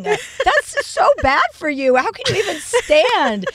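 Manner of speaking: scolding wife. Retold or imitated. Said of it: "I go".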